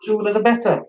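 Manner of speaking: ordinary talk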